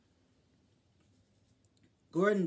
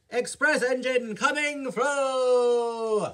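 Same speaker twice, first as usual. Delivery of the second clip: loud, slow, higher announcement; calling out; 6 semitones higher, 6 dB louder, slower than usual. Acted. Gordon racing along the line announcing himself, loud and slow. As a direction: boom it out as you race through.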